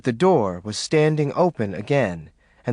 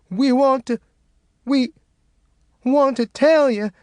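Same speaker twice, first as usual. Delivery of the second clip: sobbing; 12 semitones higher, 3 dB louder than usual. A narrator's reading.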